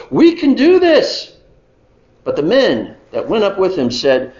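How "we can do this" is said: high, slow, loud cheer of confidence; Caleb rallying. Acted. Caleb repeating his call to the fearful spies, high and loud. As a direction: drive the point home. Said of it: "And so Caleb stands up".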